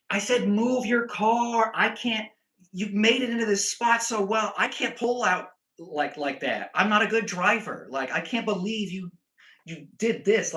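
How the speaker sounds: high-pitched, insistent mock complaining; re-enacted agitated rant. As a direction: overwhelm them with worked-up protest. Re-enacts his yelled reply, overwhelming them.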